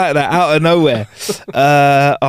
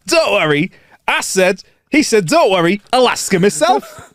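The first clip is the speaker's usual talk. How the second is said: northern accent; 7 semitones higher than usual. Shown as a demonstration. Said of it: "It does work better in a northern accent".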